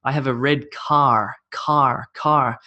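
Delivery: American "car" with the R sounded; American accent. Shown as a demonstration. Teacher contrasts Americans with Australians, American accent on "car". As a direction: say it like an American, r pronounced. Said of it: "Americans tend to go R".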